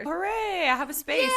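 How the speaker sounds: high, bright hooray; cheering. Real, not acted. Announcing her bookstore lease, cheering happily. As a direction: cheer it out with joy. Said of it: "celebrating her news".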